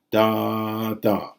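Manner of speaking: two even long beats; spondee rhythm. Shown as a demonstration. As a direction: chant two long equal syllables. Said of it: "that is, two longs".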